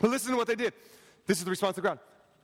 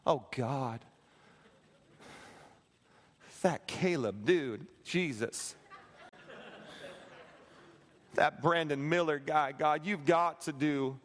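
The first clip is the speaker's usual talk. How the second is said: lower, drawn-out complaining prayer; mock grumbling prayer; 7 semitones lower, 3 dB quieter than usual. Churchgoer grumbling about others in prayer. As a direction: whine to God about people, lightly. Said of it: "this sounds like your prayer life".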